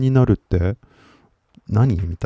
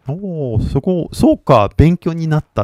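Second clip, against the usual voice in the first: higher-pitched "oh, I see" reaction; impressed realisation; 5 semitones higher than usual. Retold or imitated. Reacting to a stranger's correction, impressed. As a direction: let the pitch jump as it dawns. Retells own reaction on learning.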